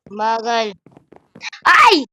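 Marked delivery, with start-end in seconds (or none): 1.6-2.0 sharp startled scream. Real, not acted. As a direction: shriek as you fall. Falling in Minecraft, screams. (sigaw habang nahuhulog)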